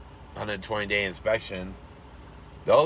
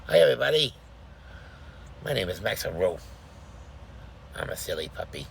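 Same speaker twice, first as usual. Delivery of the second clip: cartoon dog. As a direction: speak as the little dog. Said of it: "Maxie, wanna say hi?".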